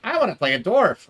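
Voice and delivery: high, keen request; eager player. Retold or imitated. A lightly voiced player begging the GM to play a dwarf. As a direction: pitch it up like an excited newcomer. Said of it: "he's like".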